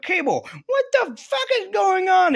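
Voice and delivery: high-pitched squawk of disbelief; bewildered outburst. Retold or imitated. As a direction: squeal it out in mock panic. Retold Cyclops demanding an explanation.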